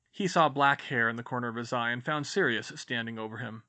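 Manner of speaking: plain reading voice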